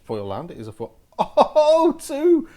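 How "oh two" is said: very high, loud excited cry; real overjoyed outburst; thrilled surprise. Real, not acted. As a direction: shriek at finding a second one. Second foil discovered.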